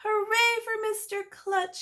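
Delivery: high, loud, slow cheer; old neighbor cheering the principal; yelled. A narrator's reading. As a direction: cheer for the hero. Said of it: "she yelled".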